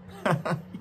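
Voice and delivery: short high chuckle; real breathy giggle at own joke; amused laugh. Real, not acted. Preacher cracks up at own pun, amused laugh.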